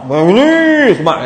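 hammed-up flattery, long drawn-out swoop; very slow, mock-admiring swell; exaggerated praise. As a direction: stretch the compliment out playfully. Acting out flattering praise of looks.

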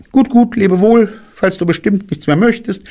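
very high, brisk rabbit voice; rabbit character. A narrator's reading. Rabbit sees bear off, high rabbit voice. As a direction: chirp goodbye far up in pitch. Rabbit hurries bear's farewell.

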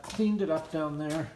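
ordinary talk, nothing marked

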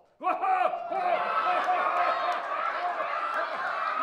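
big loud show-off cackle; hammy laugh. Acted. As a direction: howl with laughter at your own joke. Laughs at his own joke.

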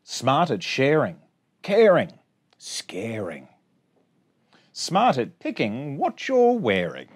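bouncy sing-song rhyme reading; reading to children. Acted. Teacher reading a rhyming picture book to her class, sing-song. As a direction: read the rhymes out brightly for little kids.

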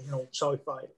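ordinary delivery

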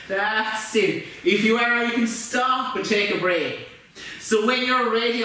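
ordinary talk, nothing marked

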